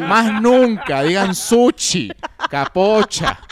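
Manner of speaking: loud, drawn-out mock scolding; scolding character. Radio host mimicking the viral character to co-host, mock scolding. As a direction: play the outraged pronunciation policeman, slowly and loudly.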